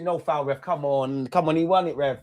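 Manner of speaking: ordinary talk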